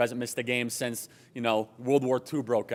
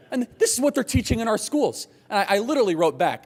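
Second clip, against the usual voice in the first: outraged rising voice; 8 semitones higher, 5 dB louder than usual. Real, not acted. Outraged at schools.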